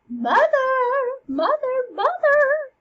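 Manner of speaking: loud, high, drawn-out calls; high ringing shout, each call stretched; calling out for mother. Acted. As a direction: holler for mother from another room. Hamlet calls from offstage.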